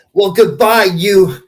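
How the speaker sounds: sing-song farewell with a playful jab; a hint of a sassy waitress; teasing waitress. Waitress voiced in retold story.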